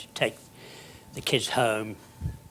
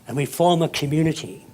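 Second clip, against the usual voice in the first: usual speaking voice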